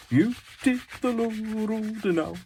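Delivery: badly sung, high-pitched mock Scottish accent. Artist breaking into an old song, mock Scottish accent. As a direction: sing it out in a hammed-up Scottish accent. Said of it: "when I start doing a Scottish accent".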